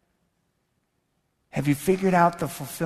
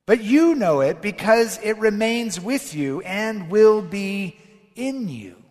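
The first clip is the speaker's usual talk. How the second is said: louder, higher preaching read of the gospel; forceful preacher reading; scripture proclamation; 5 semitones higher, 5 dB louder than usual. Acted. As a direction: proclaim Jesus' words to the congregation.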